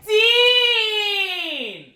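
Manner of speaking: long high-pitched shout, falling at the end; drunken call. Retold or imitated. Drunk girl arriving by Uber calls her friend, a drawn-out high holler. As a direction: imitate a drunk girl hollering a name, stretched right out.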